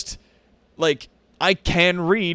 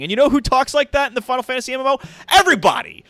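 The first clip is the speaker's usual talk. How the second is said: much higher, louder and faster, building to a shout; exasperated rant; 10 semitones higher, 5 dB louder, faster than usual. Real, not acted. Streamer venting to chat about wordy MMO dialogue, loud exasperated shout. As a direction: blow up at the ridiculous dialogue.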